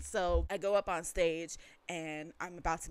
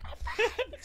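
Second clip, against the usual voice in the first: high squeak through a laugh; comically squeaked reassurance; mock flustered. Reenacting answer to worried friend.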